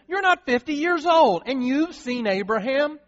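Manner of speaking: high, loud mocking disbelief; incredulous outburst. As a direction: scoff at him, how dare he.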